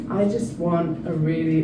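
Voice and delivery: ordinary talk, nothing marked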